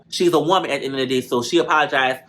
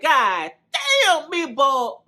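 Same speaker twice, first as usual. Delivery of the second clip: shocked exclaim; 9 semitones higher, much slower than usual. Retold or imitated. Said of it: "God, I'm like".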